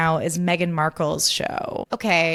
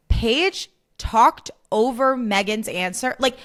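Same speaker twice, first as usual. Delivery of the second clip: ordinary delivery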